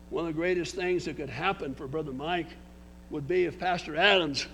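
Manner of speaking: ordinary delivery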